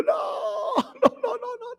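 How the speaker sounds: put-on high crying childish no no no, fading away; imitating a whining child. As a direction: plead like a kid losing his phone. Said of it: "tú lo ves a él diciendo". (imitando a un niño llorón, agudo)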